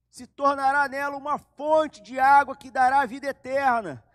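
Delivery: loud, high, ringing proclamation; preaching at full force. Acted. Preacher proclaiming Jesus's words to the congregation, loud and high. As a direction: proclaim the promise at the top of your voice.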